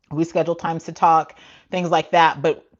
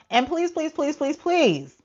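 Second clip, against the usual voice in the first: pleading; 11 semitones higher, slower than usual. Real, not acted. Begging callers to stop.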